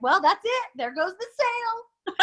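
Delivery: high, hammed-up wail of doom; embarrassed seller mock-wailing over a lost sale; mock panic. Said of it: "I was like".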